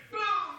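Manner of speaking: high, loud "boom"; sudden loud, high-pitched boom; imitated impact. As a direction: mimic the blast at full voice. Imitates light hitting the car.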